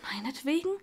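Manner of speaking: soft, hesitant question; small, unsure voice; uncertain. A narrator's reading. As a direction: ask it tentatively. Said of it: "sagte sie unsicher".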